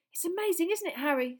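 put-on a starstruck kid bubbling over; excited Colin. Starstruck boy gushing to Harry outside, high and excited.

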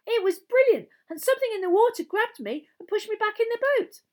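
put-on high, loud, excited boy's voice; shrill, excited. A narrator's reading. Boy tells his brother about the lake, shrill excitement. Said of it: "he said shrilly".

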